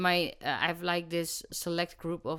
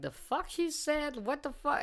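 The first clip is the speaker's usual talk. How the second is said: mock disbelief; 8 semitones higher than usual. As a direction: act stunned, pitched high. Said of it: "looking at you like".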